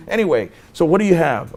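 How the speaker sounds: ordinary talk, nothing marked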